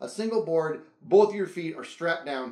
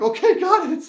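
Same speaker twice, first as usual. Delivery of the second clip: nervous laugh; 9 semitones higher, 7 dB louder than usual. Retold or imitated.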